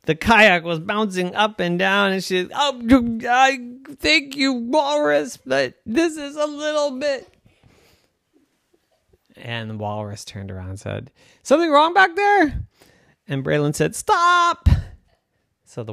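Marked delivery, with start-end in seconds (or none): 0.1-7.3 put-on high bouncy girl voice. Bouncing on waves. 11.4-14.7 squeaky cartoon voices; high character voices. Walrus asks, girl stops.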